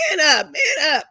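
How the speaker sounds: mock stern order. Mother retelling how she toughened up her crying son, mock stern order. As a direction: bark it like a coach, half in play.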